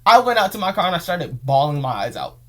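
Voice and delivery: loud, upset and close to tears; upset outburst. Real, not acted. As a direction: blurt it out hurt and loud. Emotional retelling of being let go.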